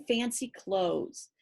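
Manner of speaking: usual speaking voice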